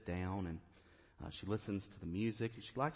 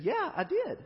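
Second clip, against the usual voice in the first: high, bright and loud reply; chirpy yes; 7 dB louder than usual. Retold or imitated. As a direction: answer proudly, almost squealing. Young mother answering pastor brightly.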